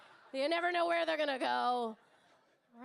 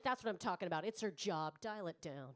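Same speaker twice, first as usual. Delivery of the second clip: put-on stern kid; 8 semitones lower, 4 dB quieter than usual. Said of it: "He's like".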